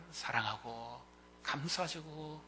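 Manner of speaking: ordinary talk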